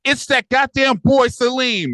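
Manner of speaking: high, slow, loud exclamation; hammed-up loud mother voice; loud Mona imitation. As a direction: belt out the name like she has no volume control. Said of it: "That's how she gonna say it hella loud".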